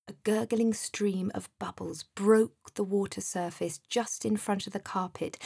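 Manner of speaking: plain reading voice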